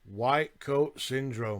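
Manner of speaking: drawn-out punchline; slow punchline. Real, not acted. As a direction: land the punchline slowly. Punchline said slowly.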